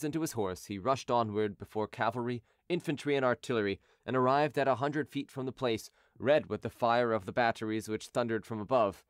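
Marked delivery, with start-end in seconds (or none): none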